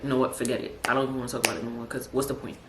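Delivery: low, soft giving up; mock-defeated, softly dropped voice; imitating shut-down resignation. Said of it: "they've been shut down".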